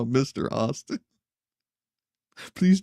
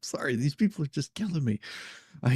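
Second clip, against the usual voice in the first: ordinary talk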